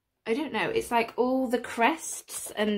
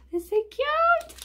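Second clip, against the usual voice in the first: cute squeal; 14 semitones higher, 3 dB louder than usual. Real, not acted. Cooing over tiny figures.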